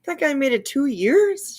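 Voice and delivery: high, drawn-out disbelief; incredulous. Real, not acted. Reacting to Laura's claim of two years, incredulous. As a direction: stretch it into an astonished high question.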